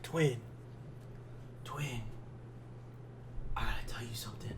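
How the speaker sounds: breathy secret whisper close to the ear. Retold or imitated. Drunk homie whispering a secret to his friend, whispered. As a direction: drop to a hushed whisper.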